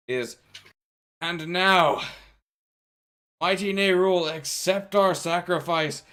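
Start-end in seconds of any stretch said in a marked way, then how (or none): 1.2-6.0 raised, louder cult leader proclaiming; grand sacrificial plea with long pauses; cult ritual invocation. Acted. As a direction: call on a dark god, higher and deliberate.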